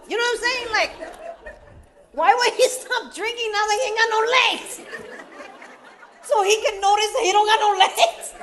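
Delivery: high, laughing incredulity; laughing disbelief. Real, not acted. Speaker joking about uncle told to quit drinking, laughing. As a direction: laugh at how absurd it is.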